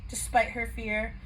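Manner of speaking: usual speaking voice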